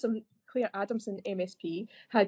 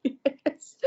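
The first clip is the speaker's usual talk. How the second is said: laughing; 5 semitones higher, 7 dB louder than usual. Real, not acted. Laughing at being called star.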